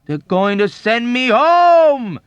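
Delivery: high, slow, loud announcement of joy; jubilant. A narrator's reading. Patient back from hospital announcing jubilantly. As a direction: crow it out with delight. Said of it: "announced jubilantly".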